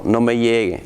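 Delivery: ordinary talk, nothing marked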